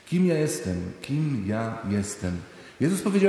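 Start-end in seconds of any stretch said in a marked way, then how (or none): 1.0-2.4 drawn-out and hushed, turned inward; slow, soft repeat. Shown as a demonstration. Preacher repeats the question to himself, slow and hushed. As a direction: ask it again slowly and quietly, as if to yourself. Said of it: "repeated slower and softer".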